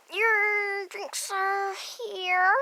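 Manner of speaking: slow, loud waiter announcing drinks. Pretend. Playing the cafe waiter.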